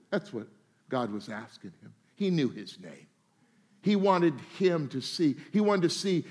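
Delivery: ordinary talk, nothing marked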